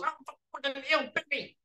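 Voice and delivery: high-pitched, loud panicked yelp; retold shriek of pain and shock; imitated scream. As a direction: act out a man screaming from a bite. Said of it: "And Dave screams, he goes".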